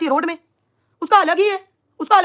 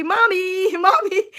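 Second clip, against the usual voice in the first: imitating a child; 4 semitones higher, much slower than usual. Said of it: "ऐसे बोल रही थी".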